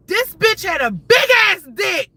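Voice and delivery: high, loud outraged exclamation; loud, scandalized announcement; shocked reveal. Real, not acted. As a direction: blurt it out loud, pitched right up. Shocked reveal of what she saw.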